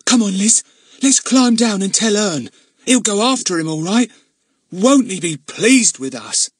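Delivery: bursting with the news; little girl eager to tell her brother; excited girl. A narrator's reading. Glad urges sister down tree.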